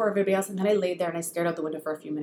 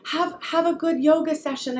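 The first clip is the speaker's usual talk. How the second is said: put-on sweet child voice; 8 semitones higher than usual. Son wishing mom well.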